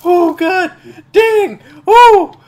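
loud, high startled yells; alarmed shouting. Real, not acted. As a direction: cry out as if caught in a blast. Caught by a grenade in game.

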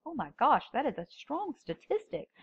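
lightly voiced, high "oh my gosh"; mock audience shock. Instructor imitates a startled listener, with mild mock surprise. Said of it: "and you go".

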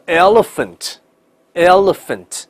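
elephant as EL-luh-fant, dark L then clear L; slow elephant, lingering on the L; American double L. Shown as a demonstration. Teacher reads 大象 for the class, slow double L. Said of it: "美音elephant".

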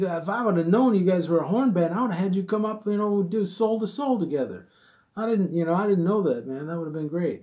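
a hint of an eager Stevie offering to jam; Stevie's reply. Stevie's reply voiced in story.

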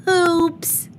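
put-on high, loud child's oops; a bright squeal of oops; squeaky oops. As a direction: burst out with a high cartoonish oops. Child oops after breaking lamp.

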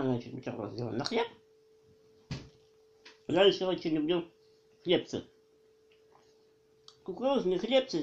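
chewing while talking; muffled through food; mouth full. Real, not acted. Seen 0: just bit sandwich; cheeks bulge, lips pursed, chewing between words.

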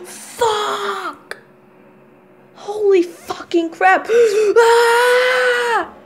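real panicked yells on a coaster; on a roller coaster. Real, not acted. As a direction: scream through the drops and loop. Screaming on a drop and loop.

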